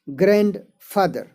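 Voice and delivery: ग्रैंडफादर split as ग्रैंड, pause, फादर; ग्रैंडफादर broken in two for learners; syllable by syllable. Shown as a demonstration. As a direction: say ग्रैंडफादर slowly in two separate parts. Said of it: "दो syllable का है".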